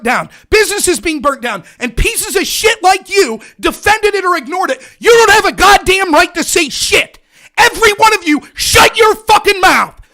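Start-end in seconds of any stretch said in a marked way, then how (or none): 5.0-10.0 loud, raw shouting at critics, slowing on the last words; furious yelling. Real, not acted. Ranting at left-wing critics, furious shout. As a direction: tear into them at full volume.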